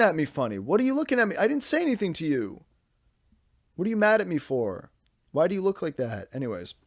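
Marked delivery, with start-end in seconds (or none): 0.6-6.3 high-pitched protest to a pet; playful indignation. Real, not acted. Podcaster to the puppy looking at him funny, playful indignation. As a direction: act affronted, pitch jumping up. Talking to his staring puppy.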